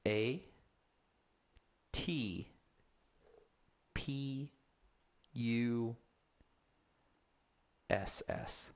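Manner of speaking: slow letter-by-letter spelling. Real, not acted. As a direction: spell each letter with pauses. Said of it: "All right, platypus".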